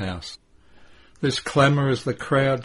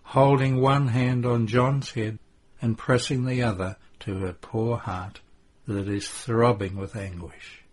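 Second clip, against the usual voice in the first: usual speaking voice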